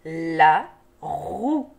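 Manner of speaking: "La roue" drawn out in two separate syllables. Shown as a demonstration. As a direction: hold the l and r of "La roue", pause between. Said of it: "des syllabes qui se donnent la main".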